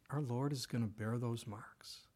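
hushed whisper; hushed reverent whisper; whispered awe. Real, not acted. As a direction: breathe it out in awe. Solemn point about eternal wounds.

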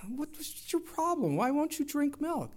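high, light mock wife voice, puzzled; husband imitating his wife's puzzled question about the milk, mock high voice. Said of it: "And Julie's like".

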